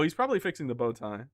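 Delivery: normal talking voice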